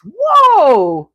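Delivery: loud, high burst of amazement; amazed whoa. Real, not acted. Reacting to a viewer's stroke recovery with a loud amazed whoa. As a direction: let it burst out in wonder.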